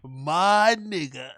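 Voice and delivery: very slow, stretched imitation; voicing Brian, drawn out. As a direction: drag the words out slowly. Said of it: "That's what he wants to say".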